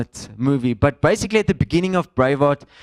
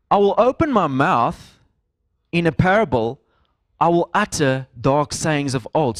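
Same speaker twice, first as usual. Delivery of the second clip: scripture reading; 3 semitones higher, 3 dB louder than usual. Acted. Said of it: "so then he's saying".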